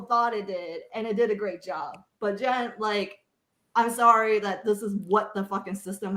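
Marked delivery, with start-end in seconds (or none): none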